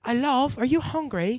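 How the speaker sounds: high hammed-up British accent; fake British accent. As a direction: play a cartoon British charmer. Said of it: "Their accent is fake".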